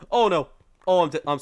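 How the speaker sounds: loud burst of dismay, falling away; loud gamer panic; alarmed outburst. Real, not acted. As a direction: cry out as doom hits. About to die in game.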